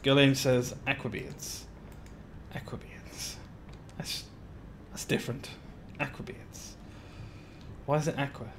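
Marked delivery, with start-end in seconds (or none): 0.9-3.4 hushed whisper, much softer; softly whispered chat name. Real, not acted. Reading chat, whispering it back. 4.0-6.8 hushed whisper, much softer; softly whispered chat name. Real, not acted. Keeps whispering the chat line.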